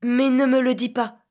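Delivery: sudden loud outcry; cried out. A narrator's reading. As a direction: cry it out. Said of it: "s'écria Philippe".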